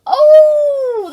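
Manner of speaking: loud, high drawn-out "oh"; embarrassed wail. Real, not acted. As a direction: cringe out loud with a long wail. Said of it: "that's embarrassing".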